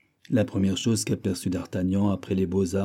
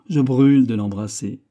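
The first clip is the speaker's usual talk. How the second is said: louder, eager outburst; eager exclamation; 3 semitones higher, 7 dB louder than usual. A narrator's reading. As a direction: exclaim with impatient affection. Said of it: "s'écria d'Artagnan". (s'exclamer avec ardeur)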